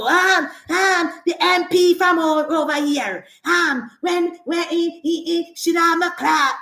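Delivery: stumbling, um-filled mimicry of a rival; Mark Golding. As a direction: imitate someone fumbling for words. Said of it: "here Mark Golding come want set back".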